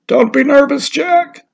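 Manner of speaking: put-on calm the nervous boy; reassuring villager. A narrator's reading.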